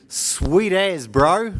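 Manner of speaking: high, loud Sweet as, bro; demo Kiwi slang. Shown as a demonstration. Kiwi speaker teaching slang to the audience, loud high demo. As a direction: sing out Sweet as, bro like a laid-back local. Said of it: "Could you repeat after me?".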